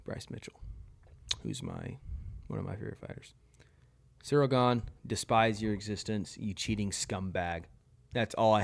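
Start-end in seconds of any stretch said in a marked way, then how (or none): 0.0-3.3 breathy whisper of the name. Real, not acted. Ranking fighters, names his favorite's conqueror in a whisper.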